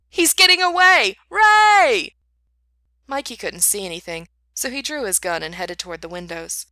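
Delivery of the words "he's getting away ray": frantic yell, much higher and louder; shouting. Acted. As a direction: scream it across the smoky room. Shouting during escape.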